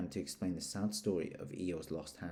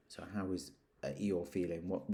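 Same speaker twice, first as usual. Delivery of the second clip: ordinary delivery, nothing marked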